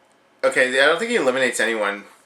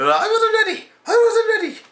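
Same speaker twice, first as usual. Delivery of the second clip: much higher panicked voice; mock flustered Santino. Santino eliminated in a second, high flustered squeak. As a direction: squeak it like a caught-off-guard wrestler.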